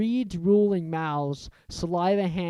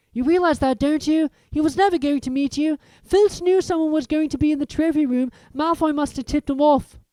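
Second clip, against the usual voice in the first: Hermione; 11 semitones higher, 7 dB louder than usual. A narrator's reading. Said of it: "Hermione said to Harry".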